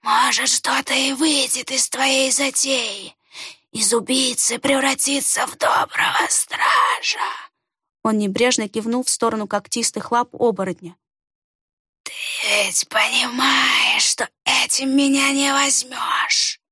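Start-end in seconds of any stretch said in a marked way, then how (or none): 0.0-7.2 stranger's whisper. A narrator's reading. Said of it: "хмыкнул незнакомец". 12.0-16.6 stranger's whisper. A narrator's reading. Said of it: "сиплый голос".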